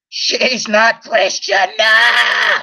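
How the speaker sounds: loud, drawn-out imitation outburst; mock outburst. Vlogger mimicking a woman on the couch, mock outburst. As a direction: imitate her crying out, stretching each word. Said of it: "every time I see her on that couch saying".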